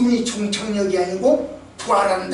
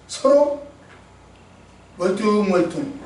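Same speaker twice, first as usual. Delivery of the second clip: ordinary delivery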